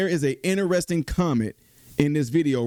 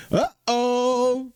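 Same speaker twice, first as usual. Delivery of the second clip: drawn-out high mock alarm; 10 semitones higher than usual. Real, not acted. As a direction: sing out a worried uh-oh. Host anticipating a bad turn.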